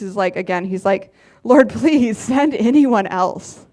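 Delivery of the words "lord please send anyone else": slow, drawn-out mock plea; slow, half-comic begging; pleading. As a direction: beg slowly, hamming up the reluctance. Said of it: "But Moses pleaded again".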